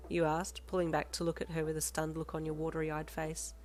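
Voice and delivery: ordinary delivery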